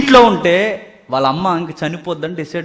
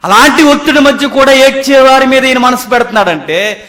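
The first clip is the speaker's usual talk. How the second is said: loud sermon peak; 8 semitones higher, 9 dB louder than usual. Acted.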